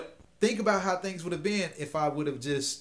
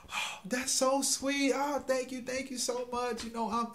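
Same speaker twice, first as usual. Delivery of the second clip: elated; 11 semitones higher than usual. Retold or imitated. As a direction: gush like a delighted woman being treated. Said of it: "she was just elated".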